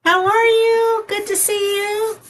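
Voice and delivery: high, slowed, gushing hello; light up at a friend's arrival; excited greeting. Real, not acted. Greeting her friend from California with excited delight. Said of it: "I get excited when certain people show up".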